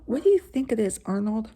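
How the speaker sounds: ordinary delivery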